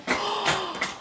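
high, soft gasp; soft, high intake of breath; delighted gasp. Real, not acted. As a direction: gasp in real surprise. Unwraps another gift.

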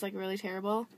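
ordinary talk

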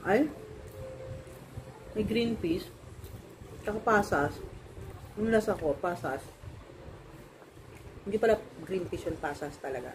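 talking between chews; speaking with food in mouth; mouth full, chewing. Real, not acted. Seen 1: Woman bit food at -5, keeps chewing while speaking throughout.

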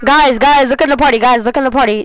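shouting over the lobby, repeating himself; loud and insistent, almost yelling. Real, not acted. Player yelling at the lobby to check the party, loud.

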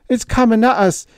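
high, loud and fast; terrified pleading. A narrator's reading. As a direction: beg her in panic. Higgins begging in terror.

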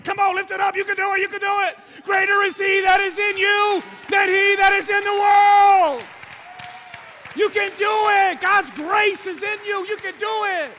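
very high, full-force shout with stretched words; shouted urging. Acted. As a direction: roar them through the last lift. Spotter and preacher urging at full force.

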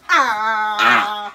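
long loud "ah" howl; gibberish. Shown as a demonstration. Owner getting the dog to vocalise, loud gibberish howl. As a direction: cry out a drawn-out nonsense noise. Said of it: "here is where the gibberish starts".

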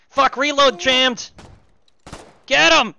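high panicked yell; yelling in alarm; frantic shout. Real, not acted. Jammed gun under fire.

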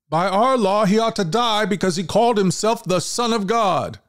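insistent accusers. Acted. Leaders protest to Pilate.

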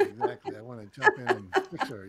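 high, loud words through laughter; laughing. Real, not acted. Husband confessing to the host, laughing loudly. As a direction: laugh while admitting it.

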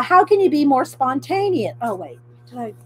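normal talking voice

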